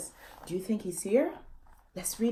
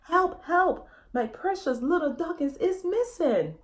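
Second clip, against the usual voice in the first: a father calling for his lost pup; frantic cry for help; 8 semitones higher than usual. Acted. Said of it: "saying in dog language".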